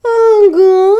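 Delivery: high, loud, crying with laughter; meowing laugh-sob. A narrator's reading. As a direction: wail it like a laughing cat. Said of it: "мяукающе рыдал от смеха Филя".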